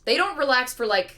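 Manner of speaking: normal talking voice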